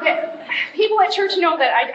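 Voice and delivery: ordinary talk, nothing marked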